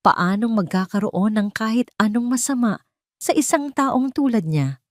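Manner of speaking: ordinary delivery, nothing marked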